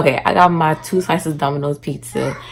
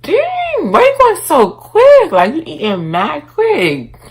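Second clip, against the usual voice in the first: teasing; 9 semitones higher, 5 dB louder, slower than usual. Retold or imitated. Teasing friend eating fast.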